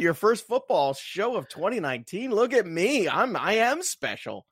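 high, swooping singsong boast; mock self-importance. Guest hamming up his first football show, mock self-importance. Showing off in put-on voice.

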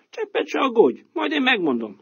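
proud donkey. Acted. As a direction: puff up and promise to show the way. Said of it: "felelte a szamár".

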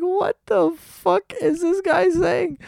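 very high, slow incredulous squeal; acted-out, high-pitched losing it; hysterical disbelief. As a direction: squeal it in stunned amazement. Acts out losing it at outburst.

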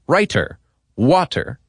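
"Writer, water" with crisp, hard T sounds; sharp T. Shown as a demonstration. Host demonstrating crisp T in "Writer, water". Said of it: "hit that T like it stole something".

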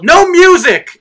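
loud high barked order; yelling. Retold or imitated. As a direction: yell it like a strict parent. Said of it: "they would yell".

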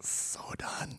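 unvoiced prompting whisper; feed the word in a whisper. Real, not acted. Whispering the answer to a co-host.

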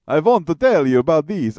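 teasing fake Russian accent gone Transylvanian; mock Transylvanian accent. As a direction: mimic a bad Transylvanian accent. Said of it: "sounding like you're from Transylvania".

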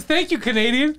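laughing quote. Retold or imitated. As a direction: repeat it while laughing. Quoting the punchline.

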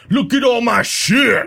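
high, slow, hammed-up showing-off drawl; James Franco's character. Reading Franco's Spring Breakers line to friends, hammed-up boast. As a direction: brag it slowly like a proud hustler. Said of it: "James Franco's character".

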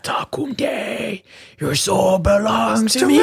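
demonic rasping whisper-shout. Pretend. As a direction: menace them like a fiend from hell.

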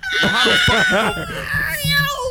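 shrill cry of laughter; shrieking laugh at a friend's blunder; high shrieking laugh. Real, not acted. Friends crack up.

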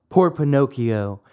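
ordinary delivery, nothing marked

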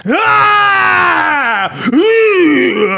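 long, high-pitched wailing scream; loud mock wail of a screaming dad; imitated dad screaming. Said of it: "my dad screaming into the phone".

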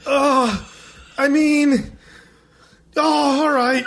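high-pitched mock hesitant agreement; reluctant teenagers. Teenagers asked to lift a big man, reluctant. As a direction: give in haltingly, imitating dismayed kids. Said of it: "with this look on their face like".

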